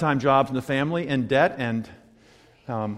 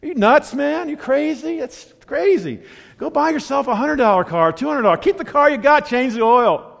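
high, incredulous mock scolding from a friend; Barney's scolding; 12 semitones higher, 8 dB louder than usual. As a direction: play the friend who thinks the plan is nuts, pitched up. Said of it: "his first response was something like".

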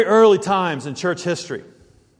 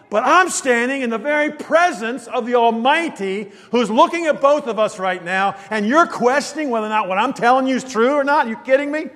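exasperated shout; 7 semitones higher than usual. Acted. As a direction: shout it at the doubter. Preacher voicing angel's rebuke.

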